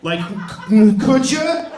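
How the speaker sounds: high, pointed could ya; annoyed businessman. Retold or imitated. Businessman glaring at the mother, a pointed high demand. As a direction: needle her with a loud, high demand. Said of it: "He looks at me like".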